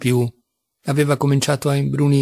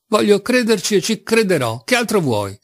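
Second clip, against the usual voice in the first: snapping back in anger; narrated angry retort; irritated shout; 7 semitones higher than usual. A narrator's reading. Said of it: "gridò Alyosha irritato".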